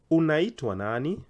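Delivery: "Unaitwa nani?" starting high and loud, swooping up on "nani"; high question intonation. Shown as a demonstration. Teacher modelling a question for learners, "nani" rising high. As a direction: lift the end of "Unaitwa nani?" sharply. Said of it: "the intonation has to go high".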